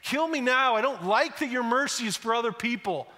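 a sulking prophet throwing a tantrum; Jonah, furious at God's mercy, demanding death; bitter outburst. Acted.

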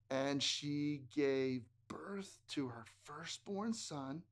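slow breathy whisper. Shown as a demonstration. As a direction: hush it like a secret. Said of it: "reciting verse for kids".

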